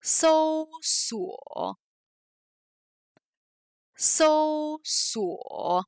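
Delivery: slow careful Chinese So, suo with tones; Chinese syllable demo. Shown as a demonstration. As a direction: hold So level, let suo drop below it. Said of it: "je vais prononcer des syllabes chinoises".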